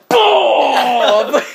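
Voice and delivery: loud, drawn-out boom; big, high, explosive sound effect; booming impact. Acted. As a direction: boom it out like a heavy blow. Acting out the head-bashing blow.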